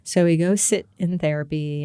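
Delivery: normal talking voice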